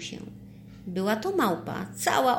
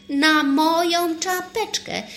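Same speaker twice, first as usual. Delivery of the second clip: loud, high exclamation, drawn out slowly; stretched-out, high excited shout; sudden shout; 9 semitones higher, 7 dB louder, slower than usual. A narrator's reading. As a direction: cry out with a sudden brainwave. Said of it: "krzyknął Nieuczek nagle".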